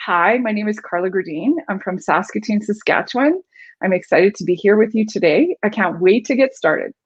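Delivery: put-on valley girl speak, rising on "Gerdine", "Saskatchewan", "today"; upspeak. Shown as a demonstration. Coach demonstrating clients' habit in an introduction, upspeak. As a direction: go up at the end of every phrase like a question. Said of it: "So it would sound like this".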